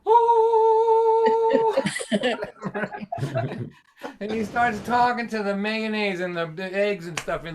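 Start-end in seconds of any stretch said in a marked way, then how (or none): 0.1-1.8 long high sung choir ahh; heavenly choir note. Retold or imitated. Comic imitating the fridge light as a choir. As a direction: sing the fridge's holy glow. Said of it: "hear the refrigerator going".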